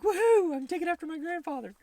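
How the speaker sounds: high, loud mock cheer; elated whoop. Host playing a fan thrilled to take after grandfather, elated high whoop. Acting out a delighted Kylo.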